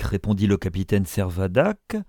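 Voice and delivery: ordinary delivery, nothing marked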